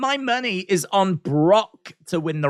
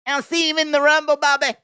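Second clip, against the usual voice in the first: lightly mocking Brock, a bit higher; imitating Brock; 8 semitones higher, 4 dB louder than usual. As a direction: echo how Brock says Bobby. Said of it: "I love how he says Bobby".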